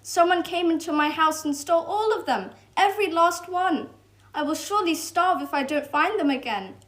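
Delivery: lightly voiced anxious squirrel; distressed squirrel. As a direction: squirrel explaining his loss.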